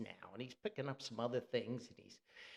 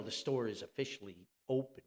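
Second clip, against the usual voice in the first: normal talking voice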